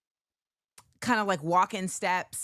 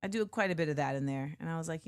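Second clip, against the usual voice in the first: normal talking voice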